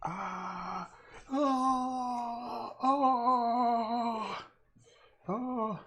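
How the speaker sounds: high wailing ahh sounds; saying ahh. Pretend. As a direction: wail like the screaming face in the painting. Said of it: "I'm making the ah sound".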